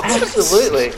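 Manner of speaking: high, bright Absolutely; hammed-up eager yes; eager exclamation. Exaggerated eager reply.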